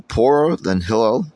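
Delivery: ordinary talk, nothing marked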